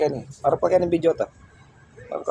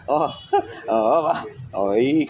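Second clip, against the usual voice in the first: ordinary talk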